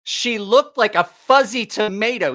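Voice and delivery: high, loud, slow outraged complaint; indignant complaint. Retold or imitated. Kelly complaining to friends about his date, slow outraged voice. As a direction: drag out the grievance, outraged. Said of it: "And he was like".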